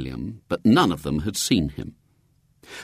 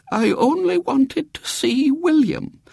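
very high, slow invalid's voice; feeble; 3 dB louder than usual. A narrator's reading. Great-aunt Jane receiving visitors from her bed, feebly. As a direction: quaver out slowly as a frail great-aunt. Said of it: "said Great-aunt Jane feebly".

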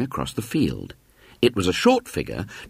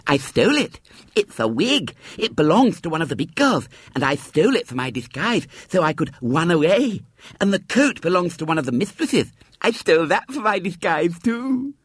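put-on high, lisping child boasting; proud little girl Violet Elizabeth; 10 semitones higher than usual. A narrator's reading. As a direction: show off proudly in a small child's voice. Narrator voices Violet Elizabeth.

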